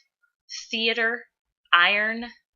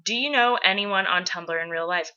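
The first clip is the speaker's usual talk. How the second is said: ordinary talk, nothing marked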